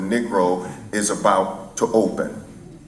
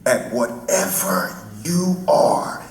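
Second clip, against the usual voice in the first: Martin Luther King; 4 semitones higher than usual. Retold or imitated. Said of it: "he said to the audience".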